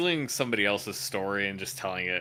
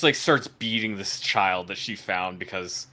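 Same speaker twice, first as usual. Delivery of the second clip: normal talking voice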